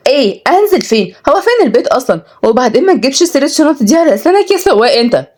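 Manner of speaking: high, indignant heiress; exasperated. A narrator's reading. Heiress to driver over house and bags, exasperated. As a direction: snap back in a high, irritated voice. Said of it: "ردت كنزي بغيظ".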